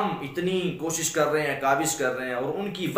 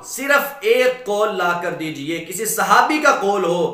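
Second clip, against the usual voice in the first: loud, raised preaching voice; full-force preaching challenge; 4 semitones higher, 6 dB louder than usual. Real, not acted. Preacher challenging objectors to bring one saying, full-force preaching. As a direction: throw down the challenge to the whole crowd.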